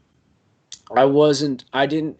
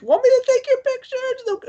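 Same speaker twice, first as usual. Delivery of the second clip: mimicking woman. Passerby woman calls to the couple, squealing falsetto. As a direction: play the stranger, squealing with delight. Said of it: "it's like".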